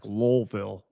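Lowville said as LOLL-vill, with an added L; extra L. Shown as a demonstration. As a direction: slip an L in before the V in Lowville. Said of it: "there's, like, an ex-, extra L".

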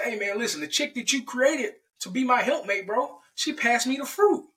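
high-pitched, indignant excuse; defensive protest. Acted. Adam blames Eve before God, defensively. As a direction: pass the blame in a high, hammed-up whine.

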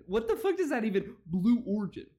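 ordinary talk

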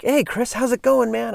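high, cheery mock greeting from Larry; imitating Larry. Larry hailing Chris at the park, bright high greeting.

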